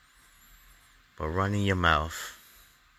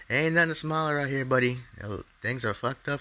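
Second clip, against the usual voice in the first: higher, fast, heated jab; angry scolding; 7 semitones higher than usual. Real, not acted. Fed-up citizen scolding the smiling mayor, heated and fast. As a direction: snap the words at him, fast and high.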